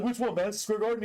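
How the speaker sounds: high, quick mock comeback; fast sassy retort; mock rival retort. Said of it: "He was like".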